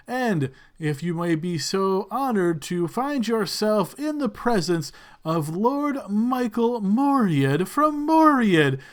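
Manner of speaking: slow, stretched tournament proclamation; ceremonial host. Acted. Lord welcoming guests to Caerlinian, grand slow ceremonial host. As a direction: announce grandly to the crowd, building to the honored name.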